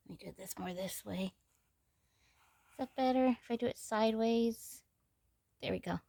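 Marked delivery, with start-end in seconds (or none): 0.1-1.3 whispered to herself; half-whispered aside while working; whispering. Real, not acted. Muttering to self while adjusting doll.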